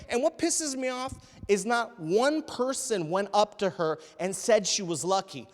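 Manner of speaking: ordinary talk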